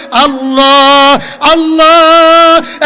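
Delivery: repeated long-held chanted cry; calling out loudly. Retold or imitated. Adam calls God again and again, calling out loudly. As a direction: cry the name out, holding each one. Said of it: "জোরে জোরে ডাক দিয়ে বলে".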